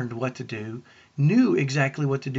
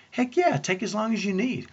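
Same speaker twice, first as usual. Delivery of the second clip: higher-pitched, eager yes; eager agreement; 7 semitones higher than usual. Retold or imitated. Husband feeling like a hero answers his tired wife, eager. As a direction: jump in like a willing hero.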